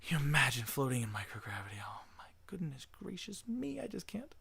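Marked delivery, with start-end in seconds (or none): 1.8-4.3 whispered in awe, higher pitch; awed whisper. Real, not acted. Streamer imagines microgravity, awed whisper. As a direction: breathe it out in wonder. Dreaming of floating in space.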